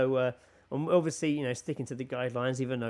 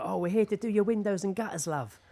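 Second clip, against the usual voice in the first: higher, cheery tradesman calling through the door; lightly voiced workman; 7 semitones higher than usual. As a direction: play the chirpy window cleaner. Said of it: "I heard them be like".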